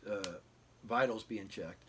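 normal talking voice

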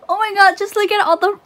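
high, loud excitement; excited gushing. Real, not acted. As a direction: squeal over what you have just unwrapped. Gushing over washi just revealed.

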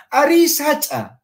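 Ari sacha dicho alto, afirmando; high answer. Shown as a demonstration. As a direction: responde Ari sacha con tono alto. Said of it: "la respuesta afirmativa". (respuesta afirmativa, en tono alto)

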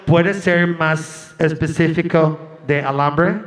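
low, slow bureaucratic request; American officer. Retold or imitated. Officer asks the woman for specifics, low and slow. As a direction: ask slowly like a puzzled clerk. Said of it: "Y el hombre".

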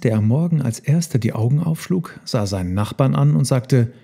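usual speaking voice

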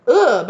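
loud, high grunt of revulsion; hammed-up, high-pitched ugh; mock disgusted groan. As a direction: recoil loudly in acted disgust. Acts the karmic's disgust.

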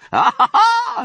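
high, loud, teasing "ah-ha-ha"; hammed-up sly fox laugh; knowing laugh. Fox keeps his secret, laughing teasingly.

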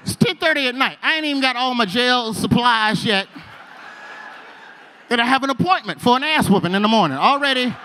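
loud, high-pitched frantic complaint; panicked outburst. Retold or imitated. New inmate facing a morning beating, loud panic. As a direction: protest loudly, voice pushed up high.

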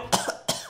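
short dry cough. Real, not acted. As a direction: cough into the mic. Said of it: "Mannaggia questa tosse". (colpo di tosse)

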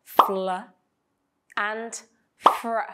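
"fl" and "fr" each said crisply as a blend. Shown as a demonstration. Teacher introducing the blends to students, crisp and clear. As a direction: model the blend sounds fl and fr for students. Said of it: "two consonant blends in English".

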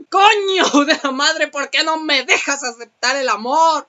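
loud, slow exasperated cursing; frustrated outburst. Real, not acted. Rejected player cursing at the game, loud and exasperated.

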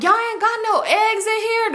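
high-pitched incredulous complaint; mock disbelief. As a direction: demand it in high, exaggerated disbelief. Acted complaint to store staff.

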